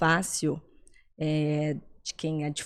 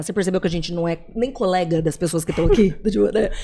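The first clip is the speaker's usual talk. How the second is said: laughing; 7 dB louder, much faster than usual. Real, not acted. Teasing her friend, laughing.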